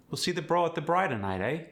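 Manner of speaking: quick South African slang with a rising "eh"; South African accent. Shown as a demonstration. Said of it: "The South African accent".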